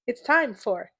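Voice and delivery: loud, slow announcer call; segment announcement. Acted. Podcast host introducing her favorite segment, announcer style. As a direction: boom out the segment title like a show host.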